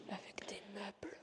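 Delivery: hushed exploring whisper; nervous night vlog whisper. Real, not acted. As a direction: whisper so nobody hears you. Exploring abandoned hotel, whispering.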